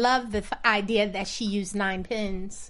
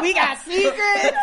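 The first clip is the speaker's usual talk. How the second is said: laughing; 10 semitones higher, 6 dB louder than usual. Real, not acted. Laughing over old roommate secrets.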